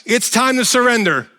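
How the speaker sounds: loud, high rebuke of surrender; commanding God. Pretend. God telling Paul to surrender, loud command. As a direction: order it from above. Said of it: "God is just like".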